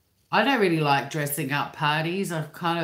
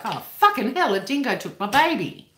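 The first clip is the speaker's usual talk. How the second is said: high, loud mock alarm in own accent; hypothetical Australian outburst; 7 semitones higher, 5 dB louder than usual. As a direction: cry out as an everyday Aussie would, hammed up. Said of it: "an Australian person, like, if I was".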